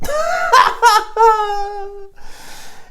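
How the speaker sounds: high cackling laugh; cackle. Acted. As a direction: cackle after your own retort. Laughs at own retort.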